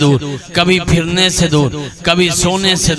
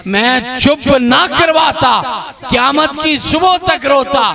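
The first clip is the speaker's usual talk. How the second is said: called out high and strong, a raised voice; raised preaching voice; 7 semitones higher than usual. Retold or imitated. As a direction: proclaim the Imam's words to the crowd. Quoting the Imam again, raised.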